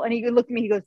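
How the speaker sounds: usual speaking voice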